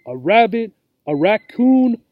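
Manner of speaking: ordinary delivery, nothing marked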